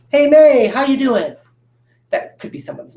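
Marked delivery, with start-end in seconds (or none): 0.1-1.3 louder, higher-pitched pretend hello; mock greeting. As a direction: call out a playful hello to someone. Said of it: "I don't say May".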